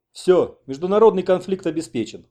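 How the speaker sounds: high, loud lament; moaning dismay. A narrator's reading. Taras laments a looming international conflict, moaning. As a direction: groan as if disaster is certain. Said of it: "стонал Тарас Апанасович".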